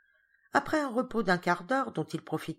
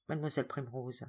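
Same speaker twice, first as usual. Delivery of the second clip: ordinary delivery, nothing marked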